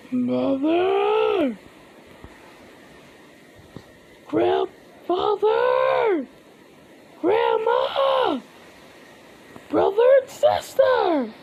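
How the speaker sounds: high, desperate shouts of names; calling out. Acted. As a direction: call each name, drawn out. Calling family after the massacre.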